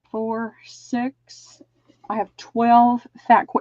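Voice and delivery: normal talking voice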